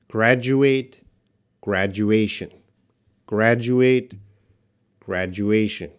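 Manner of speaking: "Graduate" and "graduation" as separate, carefully enunciated model words; pronunciation demo. Shown as a demonstration. Teacher modelling requested words, "Graduate, graduation" in measured, clear alternation. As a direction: say "Graduate" ending in "ate", "graduation" ending in "ay-shun", pausing between. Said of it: "Could you please pronounce".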